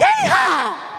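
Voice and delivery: high yelled yeehaw; cowboy whoop. Real, not acted. Celebratory whoop.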